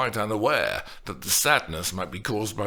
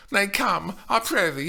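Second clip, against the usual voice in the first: put-on high girlish demand; 11 semitones higher than usual. A narrator's reading. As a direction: insist as young Juliet. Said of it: "she demanded".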